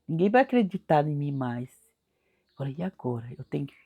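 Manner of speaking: bem mais suave, quase sumindo; desespero baixinho. Retold or imitated. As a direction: fala pra si, sem força. (desespero em voz baixa)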